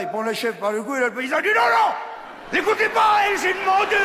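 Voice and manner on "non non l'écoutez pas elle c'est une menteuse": very high, loud protest; panicked yelling farmer, much higher and louder; panicked shout. Acted. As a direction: shriek to stop the goat.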